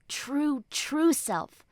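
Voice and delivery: ordinary delivery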